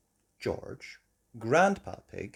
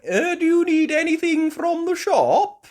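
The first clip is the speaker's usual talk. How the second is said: very high, loud little piglet; Peppa asking; 11 dB louder than usual. A narrator's reading. Peppa sailing house to house, high eager voice. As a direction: call out like an eager little girl.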